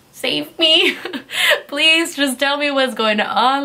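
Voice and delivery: high plea broken by laughs; laughing plea. Retold or imitated. Sixteen-year-old looking at the cop in the car, laughing plea. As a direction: laugh through a mock desperate plea.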